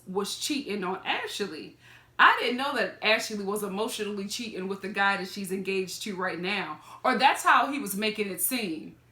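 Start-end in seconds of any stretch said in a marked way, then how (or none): none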